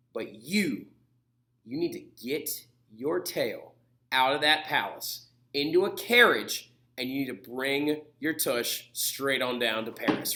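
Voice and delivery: put-on scolding the king like a child; women telling the king to get to Paris, low and sassy; stern order.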